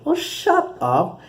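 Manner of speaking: high, slow, drawn-out mock brush-off; retorting to the former manager with a high, slow scoff; mock dismissive. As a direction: stretch it out high and scoffing, teasing a friend. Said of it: "then I was like".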